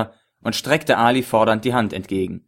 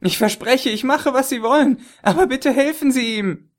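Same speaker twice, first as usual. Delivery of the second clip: desperate pleading; 14 semitones higher than usual. A narrator's reading. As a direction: beg for his life. Chess fleht um Hilfe. (verzweifeltes Flehen)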